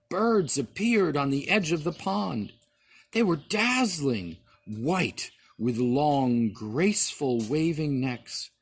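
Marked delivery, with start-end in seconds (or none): none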